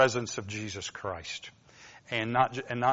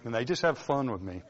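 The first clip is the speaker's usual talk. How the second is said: ordinary talk, nothing marked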